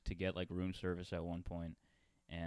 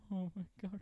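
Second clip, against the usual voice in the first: mock shocked waiter; 11 semitones higher, 3 dB quieter than usual. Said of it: "And he's like".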